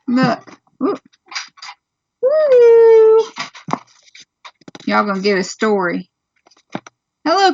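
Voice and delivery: storybook read-aloud voice to little ones. Real, not acted. Mother reading a farm book to her children, storybook voice.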